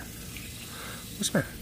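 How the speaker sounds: soft unvoiced coaxing; whispering. Real, not acted. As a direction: whisper it gently to a pet.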